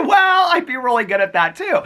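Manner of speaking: cracking up while trying to talk; laughing hard. Real, not acted. Laughing at the prostitution mixup.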